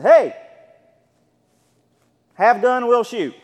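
loud, sharp command from the doorway; shouted warning. Retold or imitated. Pastor at the door yelling at a prowler, loud warning shout. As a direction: yell it to scare him off.

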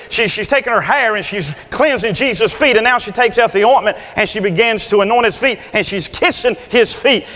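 usual speaking voice

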